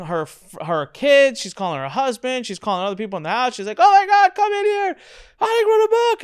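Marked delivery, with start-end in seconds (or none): 3.8-6.2 very high, loud mock yell; excited shout. Cousin calling relatives in about the book, high excited yell. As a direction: shriek it across the house.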